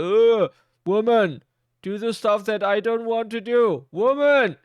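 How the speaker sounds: high, loud, whiny bossy man; mocking entitled husband. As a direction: whine and bark at the wife like a lazy husband. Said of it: "the guy is like".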